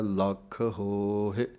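ਲੱਖ with a held, doubled kh, slow; doubled consonant. Shown as a demonstration. Teacher shows students the addhak in ਲੱਖ, slow recitation. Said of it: "ਅੱਧਕ ਦੇ ਨਾਲ ਬੋਲਣਾ ਹੈ".

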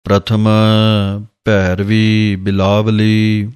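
slow, drawn-out devotional reading of gurbani; slow scripture recitation. Acted. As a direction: recite each word slowly and reverently. (ਹੌਲੀ ਹੌਲੀ ਗੁਰਬਾਣੀ ਦਾ ਪਾਠ)

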